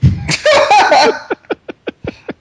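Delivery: big burst of laughter; loud laugh. Real, not acted. Laughing at joke.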